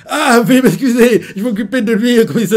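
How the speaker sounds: bow and scrape before the superior; embarrassed officer apology. Retold or imitated.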